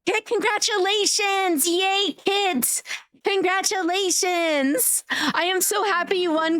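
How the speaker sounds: high, drawn-out cheering; excited congratulations. Real, not acted. Streamer congratulating a raffle winner, high and excited.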